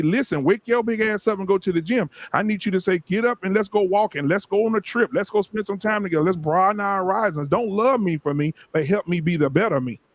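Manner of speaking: fervent preacher cadence, high and strong; preaching. Acted. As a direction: preach it to the congregation. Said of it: "You preaching there".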